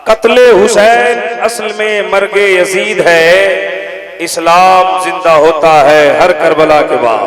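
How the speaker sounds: loud, measured verse recitation; ringing stage recital of poetry; declaimed couplet. Acted. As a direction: proclaim each phrase to the crowd at full voice.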